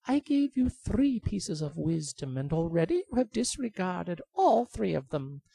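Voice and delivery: high, lecturing bird voice; high scolding bird. Acted. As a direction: scold him point by point.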